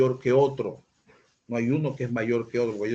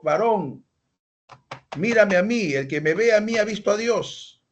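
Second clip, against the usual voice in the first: a mock proud preacher, pitched higher and louder; arrogant pastor; 8 semitones higher, 3 dB louder than usual. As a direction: puff up like a self-important pastor. Pastor arrogante imitado por el predicador. (imitando a un pastor soberbio, más agudo y fuerte)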